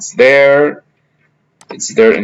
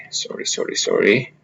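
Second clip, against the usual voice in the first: whispered sorry; 2 semitones lower, 10 dB quieter than usual. Real, not acted. Apologising for an interruption.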